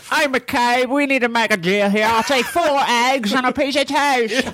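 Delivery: high, over-the-top Cockney madam; Cockney impression. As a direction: camp up a fake Cockney accent. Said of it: "That's, that's a good impression".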